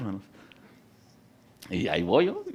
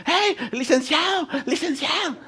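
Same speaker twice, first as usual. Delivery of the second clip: prisoners calling out; slower than usual. Retold or imitated.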